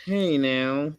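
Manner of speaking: put-on high, lingering call; Ice. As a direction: draw out a high imitated "hey". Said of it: "Like, Ice is like".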